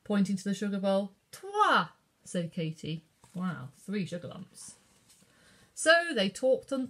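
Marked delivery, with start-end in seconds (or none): 1.3-1.9 much higher, louder eager answer; eager child. A narrator's reading. Katie asks for three sugar lumps, eager. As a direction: chirp it greedily.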